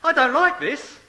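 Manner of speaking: hammed-up baby's complaint; complaining baby. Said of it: "the baby might be thinking".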